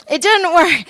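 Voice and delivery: it didn't work said high and loud; loud mock exasperation. Joking to the congregation, a loud mock complaint. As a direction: throw it out with comic frustration. Joking about failed manipulation.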